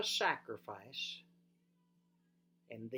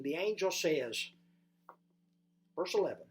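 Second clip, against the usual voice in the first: ordinary talk, nothing marked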